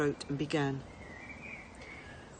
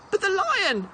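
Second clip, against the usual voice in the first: high, loud desperate cry; a boy crying out in alarm; child's cry of dismay; cried out; 7 dB louder than usual. A narrator's reading. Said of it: "he cried".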